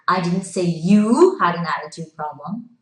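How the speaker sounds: emphatic "you" mid-sentence; stress on YOU. Shown as a demonstration. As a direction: put all the weight on the word "you". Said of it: "emphasizing a different word".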